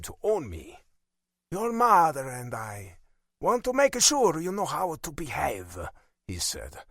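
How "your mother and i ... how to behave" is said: stern Italian father. A narrator's reading. Father warning his son about behaviour, stern Italian accent.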